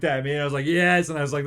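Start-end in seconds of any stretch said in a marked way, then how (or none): 0.7-1.1 loud, high cheer; retold triumph. Father recalling the plunge with his son, exultant. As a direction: relive the exhilarated yell. Retelling triumph after jumping in.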